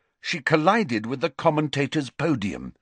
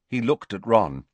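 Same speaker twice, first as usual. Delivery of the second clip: plain reading voice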